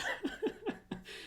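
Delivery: high, airy giggling laugh; amused laugh. Real, not acted. Amused at co-host's quip, high laughter. Laughs at joke about Tumblr.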